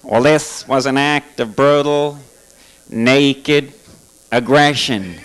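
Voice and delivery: mock-solemn Bush speech; George Bush impression. Comic imitates Bush denouncing aggression, slow low drawl. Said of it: "and he's saying".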